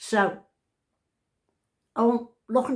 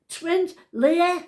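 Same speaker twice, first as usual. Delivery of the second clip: calling out; 8 semitones higher, 6 dB louder than usual. Retold or imitated. Calling to daughter upstairs.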